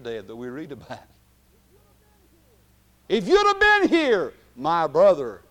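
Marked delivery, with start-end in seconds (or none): 3.1-5.4 Mary's anguished cry. Acted. Preacher as Mary lamenting to Jesus, loud then falling. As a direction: cry out the grief then drop.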